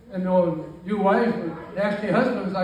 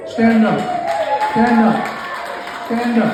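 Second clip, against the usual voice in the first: loud, raised call to the room; higher, projected command to a crowd; 4 semitones higher, 5 dB louder than usual. Real, not acted. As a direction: summon the family to their feet, loudly. Calls relatives in audience to stand.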